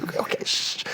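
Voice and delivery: whispered, hushing everyone around; urgent little shush so nobody notices; hushed shush. Retold or imitated. Kevin hushing the fan in a whisper.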